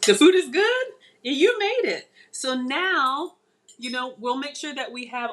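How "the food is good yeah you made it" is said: surprised. Real, not acted. Reacts to husband's praise.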